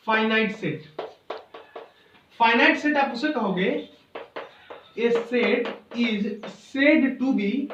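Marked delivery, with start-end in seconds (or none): 5.0-7.7 slow dictation. Acted. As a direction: dictate it word by word, repeating phrases. Dictating a definition to students.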